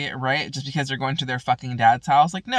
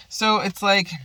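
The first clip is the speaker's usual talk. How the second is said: ordinary talk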